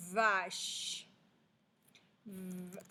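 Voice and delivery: VACHE with long v, then V alone; elongated initial v. Shown as a demonstration. Teacher shows the first sound, "vache" stretched at v. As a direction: buzz the v of "vache". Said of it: "c'est le son V".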